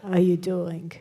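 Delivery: slow, cranky East Coast drawl; cranky New York accent. Retold or imitated. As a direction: growl it out like a grumpy New York dad. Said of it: "crankier and kinda monotone".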